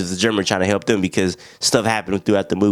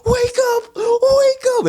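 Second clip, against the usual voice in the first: much higher, desperate crying scream, slowed; frantic screaming; much slower than usual. Retold or imitated. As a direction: scream at him to wake up. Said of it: "Blake was just screaming at him".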